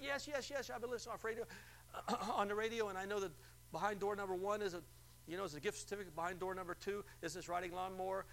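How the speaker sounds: high, breathless yes yes yes; eager caller. Retold or imitated. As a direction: rush it like a thrilled winner. Acted excited radio caller.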